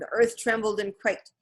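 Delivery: ordinary delivery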